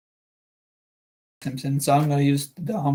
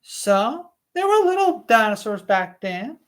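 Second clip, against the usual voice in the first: higher female character voice; Alice Levin voice; 5 semitones higher than usual. Acted. Alice Levin to Doctor Stone, higher female voice. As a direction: play a chatty mother, pitched up. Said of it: "Alice said".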